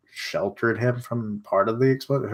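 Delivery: normal talking voice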